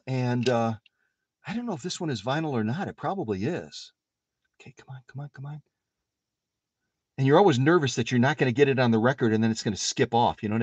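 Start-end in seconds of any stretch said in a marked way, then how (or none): 4.6-5.6 very soft talking to oneself; hushed, low urging while concentrating; muttered coaxing. Real, not acted. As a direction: coax it under your breath. Coaxing needle onto record.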